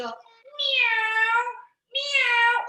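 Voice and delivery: high-pitched drawn-out meow; high mimicked cat cry; imitated cat meow. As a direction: meow like a pretend kitty. Imitating a cat in vet lesson.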